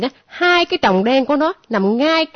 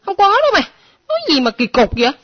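flustered denial; 4 semitones higher, 4 dB louder than usual. Acted. Denies rumour, flustered.

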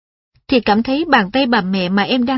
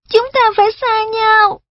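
put-on child voice; 12 semitones higher, 4 dB louder than usual. Mother tells child news.

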